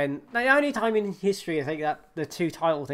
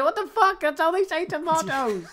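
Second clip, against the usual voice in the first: 1 incredulous protest; 11 semitones higher than usual. Real, not acted. Defending himself, laughing.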